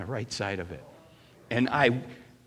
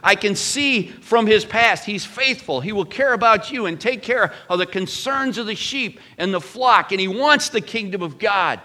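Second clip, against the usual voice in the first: much higher and louder, sustained and fervent; full-force preaching; 10 semitones higher, 9 dB louder than usual. Acted. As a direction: preach it to the back row.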